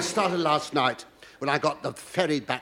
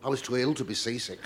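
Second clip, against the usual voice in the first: normal talking voice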